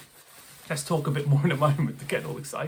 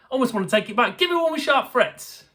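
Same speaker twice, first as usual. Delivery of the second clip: loud, high ironic demand; hammed-up protest; mock complaint; 8 semitones higher, 5 dB louder than usual. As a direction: pretend to be outraged.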